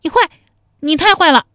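high, loud coy mock outrage; girlish mock-angry outburst; pretend anger. A narrator's reading. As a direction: scold him in playful fake anger. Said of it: "假装生气说".

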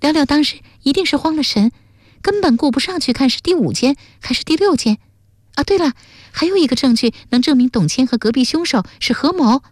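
put-on high, excited girl's voice; high-voiced, eager reasoning; eager young sleuth girl. A narrator's reading. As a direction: argue the theory keenly, pitched high.

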